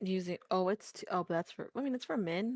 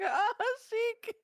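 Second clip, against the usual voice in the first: high mock sigh; 13 semitones higher, 4 dB louder than usual. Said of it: "I was like".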